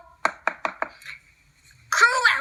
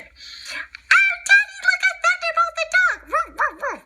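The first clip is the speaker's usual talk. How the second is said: put-on much higher child voice, excited; excited puppy. Puppy calls Daddy to watch Thunderbolt on TV, high excited child voice. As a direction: call Daddy to the TV like an eager pup.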